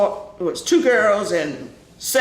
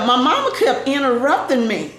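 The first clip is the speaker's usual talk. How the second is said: ordinary talk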